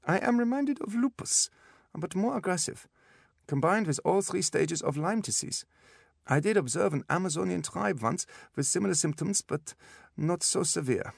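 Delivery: put-on high German-accented prognosis; blunt German doctor. A narrator's reading. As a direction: deliver bad news bluntly in a German accent. German doctor figure speaks.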